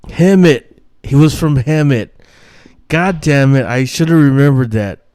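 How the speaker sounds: loud, high shout of remembering; loud triumphant outburst at remembering the town; excited realization. Real, not acted. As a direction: blurt it out the moment it comes back.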